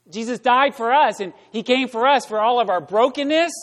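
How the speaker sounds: preaching. Acted. Pastor preaching brokenness to the congregation, emphatic. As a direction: hammer each line home.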